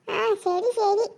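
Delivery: ordinary talk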